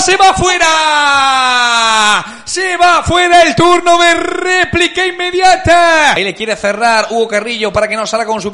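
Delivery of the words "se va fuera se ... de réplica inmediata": slow, loud, stretched call; drawn-out shout. Real, not acted. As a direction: stretch the miss out. Shot wide, shouted.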